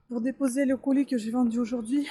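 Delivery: usual speaking voice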